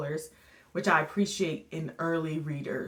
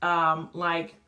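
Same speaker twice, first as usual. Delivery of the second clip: ordinary talk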